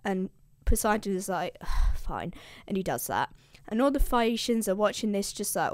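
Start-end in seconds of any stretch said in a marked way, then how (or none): 2.0-2.3 low, soft, reluctant concession; grudging agreement. Retold or imitated. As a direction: give in as a mock-sulky god. Poseidon gives in to Zeus.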